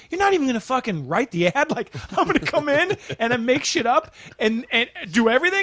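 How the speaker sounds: high-pitched disbelieving outburst; outraged, ranting in disbelief; incredulous rant. Retold or imitated. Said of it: "and I'm like".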